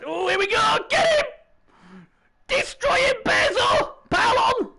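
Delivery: very high-pitched yells of encouragement; frenzied high game-stream cheering; real excited shouting. Real, not acted. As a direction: cheer the players on at the top of your range. Streamer cheering on his players.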